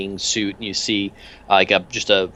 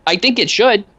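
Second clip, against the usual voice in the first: much higher, cheery odd little thug; much higher goofy henchman voice; Peanuts voice; 8 semitones higher than usual. Acted. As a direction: chirp the question brightly.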